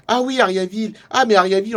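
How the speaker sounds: light up at a familiar name; excited recognition. Acted. Traveller hearing a town he has visited, excited recognition.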